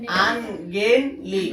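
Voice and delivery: slow, split "আনগেইনলি"; syllable by syllable. Shown as a demonstration. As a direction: count out "আনগেইনলি" in three separate syllables. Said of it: "শব্দাংশ কয়টা দেখো". ("আনগেইনলি" ধীরে ভেঙে ভেঙে)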